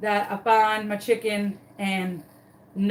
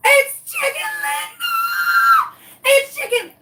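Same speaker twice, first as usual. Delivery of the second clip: very high, loud, tearful joyful scream; ecstatic shriek; 7 dB louder, slower than usual. Retold or imitated. Owner finding her lost chicken, ecstatic shriek. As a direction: shriek with overwhelming relief. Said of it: "I was so excited".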